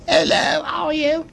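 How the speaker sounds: very high Cockney hello; Cockney greeting. Shown as a demonstration. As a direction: chirp it in Cockney. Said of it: "I was a Cockney".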